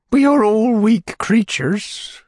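slow, high falsetto pronouncement; high-voiced lady. A narrator's reading. Lady lays down a general principle, high slow voice. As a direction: declare it plaintively, pitched right up.

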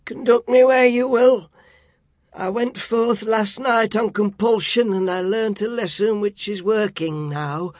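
put-on high, meek old man voice; submissive Scrooge. A narrator's reading. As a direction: plead meekly like a frightened miser. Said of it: "said Scrooge submissively".

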